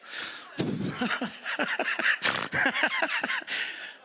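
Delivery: long, very high unvoiced giggle; high laughing fit. Real, not acted. Comic cracking up at his own story in a high giggle. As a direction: lose it in a squeaky laugh.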